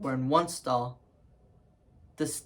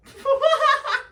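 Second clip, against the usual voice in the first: loud, high-pitched cackle; burst of laughter; 8 dB louder than usual. Acted. Bursts out at the quoted threat.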